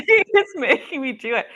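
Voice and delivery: high, loud giggle through the words; laughing. Real, not acted. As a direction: giggle as you admit it. Laughs, admitting she's crying.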